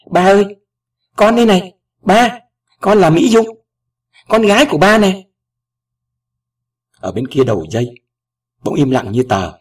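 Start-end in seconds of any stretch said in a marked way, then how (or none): 0.1-5.3 high, loud emotional outcry; choked-up cry out. A narrator's reading. Daughter revealing herself to her father by phone, choked-up cry. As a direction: cry out to a long-lost father, overcome. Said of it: "Tôi nghẹn ngào kêu lên".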